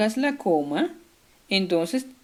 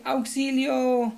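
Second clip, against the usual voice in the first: auxilio drawn out slowly, a bit higher; exclaimed long; 5 semitones higher, much slower than usual. Shown as a demonstration. Teacher repeating the example, drawn-out auxilio. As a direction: stretch out auxilio. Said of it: "varios signos de exclamación".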